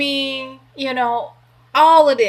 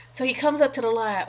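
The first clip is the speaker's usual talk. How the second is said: normal talking voice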